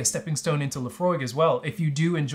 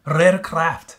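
put-on Scottish accent. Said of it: "speak in a Scottish accent".

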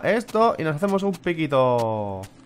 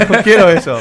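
laughing; 4 semitones higher, 12 dB louder than usual. Real, not acted. Laughing complaint.

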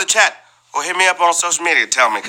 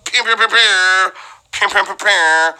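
laser noises; 6 semitones higher than usual. Acted. Imitating laser sounds.

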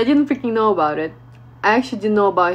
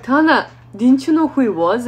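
loud, raised-pitch challenge; retold exasperated question; incredulous scolding; 6 semitones higher, 8 dB louder than usual. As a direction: imitate friends demanding an explanation. Friends confront her in disbelief.

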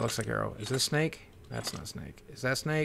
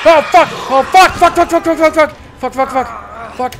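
high loud frantic yelling; shrieking frantic swears; panicked screaming; 8 semitones higher, 11 dB louder than usual. Real, not acted. Panicked attack in game.

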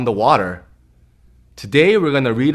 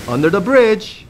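put-on reading to children. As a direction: read it like bedtime for little ones. Reads picture book to kids.

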